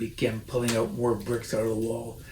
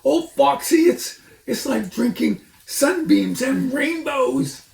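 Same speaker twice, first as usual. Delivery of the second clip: gasping; 13 semitones higher, 6 dB louder than usual. A narrator's reading. Badger gasps after drinking the cider. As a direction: gasp it out in bliss.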